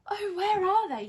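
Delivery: high, loud desperate search; frantic cry. Acted. Winnie hunts for her spell book in the rain, a high loud frantic cry. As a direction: cry out in a panic. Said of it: "she cried".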